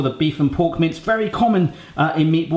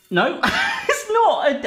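laughing correction; much slower than usual. Real, not acted. Realises scale is wrong.

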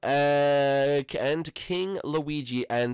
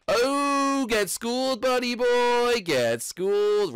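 taunting; 12 semitones higher, 5 dB louder, much slower than usual. Real, not acted. Taunting the killer.

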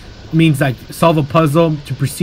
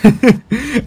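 short higher-pitched chuckle; amused laugh; 8 semitones higher than usual. Real, not acted. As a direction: laugh at your own crude joke. Amused at own insult.

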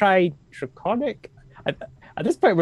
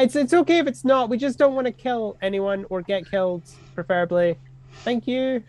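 timid polite voice; 7 semitones higher than usual. Acted. Character keeps talking to goblins.

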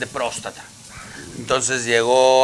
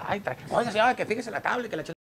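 higher, fast nagging passenger voice; complaining passenger; 8 semitones higher, 8 dB quieter, faster than usual. Retold or imitated. Rider complains to the surfer about his board, higher and fast. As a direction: fuss at the guy like an annoyed rider.

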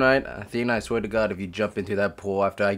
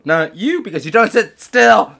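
loud, raised-pitch telling-off; scolding a dog; 10 semitones higher, 10 dB louder than usual. Real, not acted. Owner to a dog who won't sit, loud scolding.

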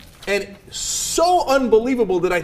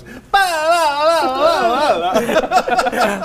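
mock Spanish accent; 8 semitones higher, 4 dB louder, much slower than usual. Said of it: "I don't know what accent that was".

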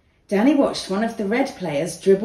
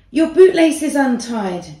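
loud, high warning call; shouting; 5 semitones higher, 6 dB louder than usual. A narrator's reading. Danny warns Asher across the pitch, loud call. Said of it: "Danny shouted".

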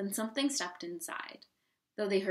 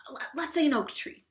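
high, hissed stage whisper; frantic, high-pitched conferring; desperate whispering; 8 semitones higher, 4 dB louder than usual. A narrator's reading. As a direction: confer urgently, stumbling over the words. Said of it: "they whispered".

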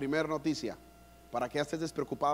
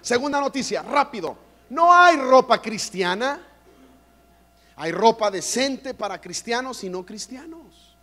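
loud, higher preacher's proclamation, slowing on the key line; full-force preaching; 8 semitones higher, 10 dB louder than usual. Acted. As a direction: thunder the news to the congregation. Preaching climax.

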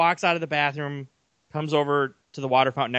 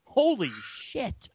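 amazed; 8 semitones higher, much slower than usual. Retold or imitated. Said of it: "I was like".

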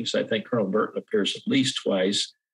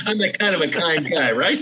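laughing; 6 semitones higher, 3 dB louder than usual. Real, not acted. Jokey self-praise after a laugh.

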